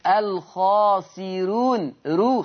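very slow "الخاسرون", its ra light; thinned ra. Shown as a demonstration. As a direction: show the thin "رو" of "الخاسرون". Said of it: "خلي بالكم من صوت الراء".

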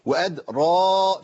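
"وأدرى" with a long held final aa; recited madd. Shown as a demonstration. Teacher showing the small alif, "وأدرى" drawn out. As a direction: stretch the end of "وأدرى" slowly. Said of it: "في ألف برضه خنجرية صغيرة".

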